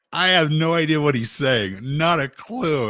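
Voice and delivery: loud amused confusion; baffled exclaim. Retold or imitated. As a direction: throw up your hands, totally lost. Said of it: "And, and I'm like".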